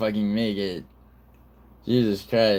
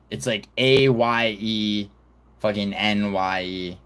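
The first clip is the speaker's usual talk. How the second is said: ordinary talk, nothing marked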